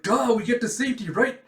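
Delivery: quick, high, loud retort; acted rushed schoolgirl reply; urgent "duh". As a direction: hurry them along, exasperated. Becky answers in the panic.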